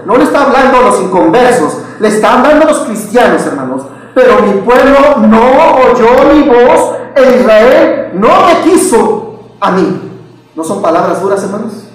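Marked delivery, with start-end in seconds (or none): 4.2-10.1 slow, raised, drawn-out verse reading; emphatic scripture reading. Shown as a demonstration. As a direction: read it slowly, weighing every word. Verse re-read slowly for weight. (lectura bíblica lenta y enfática)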